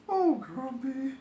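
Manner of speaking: high tender princess voice. Acted.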